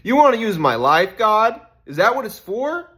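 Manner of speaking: laughing, high and loud, in disbelief; stunned, loud, laughing question; incredulous laugh. Real, not acted.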